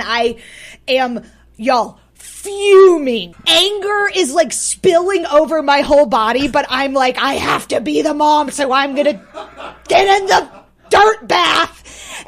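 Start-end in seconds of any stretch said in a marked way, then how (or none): 2.2-6.5 high, loud, boiling-over anger; theatrical exasperated outburst; hammed-up fuming. 7.2-12.3 high, loud, laughing resolve; mock-furious parent at breaking point; hammed-up exasperation. Acts out forcing herself into bath.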